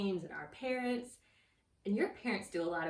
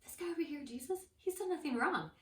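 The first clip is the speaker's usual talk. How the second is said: higher-pitched acted pleading; shocked protest; 7 semitones higher than usual. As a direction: Pilate protesting in disbelief. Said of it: "Pilate was shocked".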